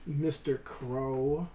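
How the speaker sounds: usual speaking voice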